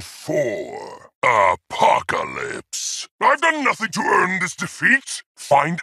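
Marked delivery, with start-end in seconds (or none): 3.2-5.2 high, fast angry protest; indignant outburst. Acted. Warlord protesting his defeat, indignant and fast. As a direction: spit out the protest, raised and rushed.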